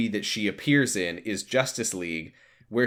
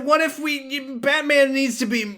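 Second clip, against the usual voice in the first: high, eager pitch-meeting voice; mock Hollywood executive spitballing; mock studio executive; 10 semitones higher than usual. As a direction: pitch it like a clueless exec. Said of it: "Because they said".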